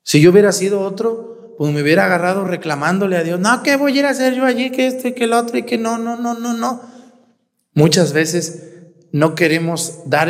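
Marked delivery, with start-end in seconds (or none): 3.4-6.8 higher, faster mock grumbling at God; whiny complaint. Preacher imitating a complainer griping at God, mock whine. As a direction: sulk like someone refusing the call.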